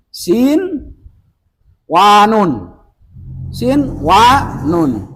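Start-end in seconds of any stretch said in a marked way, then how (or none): none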